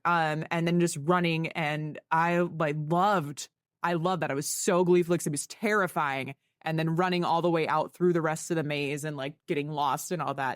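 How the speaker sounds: normal talking voice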